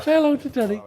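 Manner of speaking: high, sing-song voice for the dog; talking to the dog. Real, not acted. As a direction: coo at the little dog. Introducing the dog Danny.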